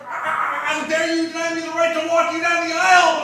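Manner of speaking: loud, high, wounded outrage; indignant outrage. Retold or imitated. Father denied walking his daughter down the aisle, loud outrage. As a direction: rail at losing the walk down the aisle. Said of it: "my mind went from".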